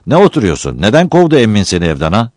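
loud, stern headman; stern questioning. Acted. Headman demands why the uncle threw him out, loud and stern. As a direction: demand an answer, sharply.

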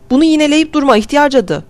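high-pitched scolding yell; angry yelling. A narrator's reading. Boy scolding an old woman for repeating herself, yelling. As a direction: yell at her to stop, pitched well up. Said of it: "diye bağırıyordu".

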